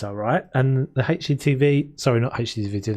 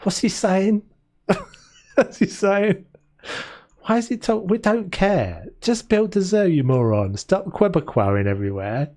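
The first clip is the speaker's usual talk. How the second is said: mock chat voice; 7 semitones higher than usual. Mocking viewer complaint about accent.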